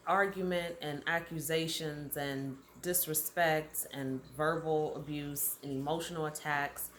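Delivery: ordinary talk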